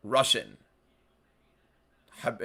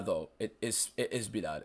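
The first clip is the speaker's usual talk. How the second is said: ordinary delivery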